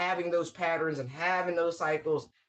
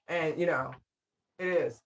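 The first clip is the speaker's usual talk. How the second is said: normal talking voice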